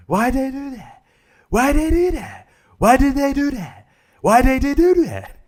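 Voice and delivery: very high-pitched, squeaky incredulous question; mock bewildered public. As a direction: repeat it in a silly high falsetto. Mimics people's baffled reaction.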